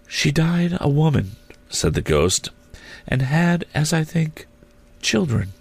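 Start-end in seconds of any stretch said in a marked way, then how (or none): 0.1-1.3 high, airy spirit voice; high otherworldly voice; ghost. A narrator's reading. The ghost's voice in narration. 3.1-5.5 high, airy spirit voice; high otherworldly voice; ghost. A narrator's reading. The ghost's voice in narration.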